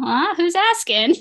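rising, wary question; suspicious surprise. Retold or imitated. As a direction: sound caught off guard and suspicious. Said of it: "And she's like".

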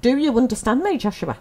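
playful mock-stern teaching assistant. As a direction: ask it like a firm teaching assistant. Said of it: "I'm gonna get my teaching assistant voice on now".

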